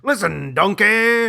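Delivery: loud gruff ogre; Shrek voice. Pretend. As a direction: scold like the ogre. Shrek impression.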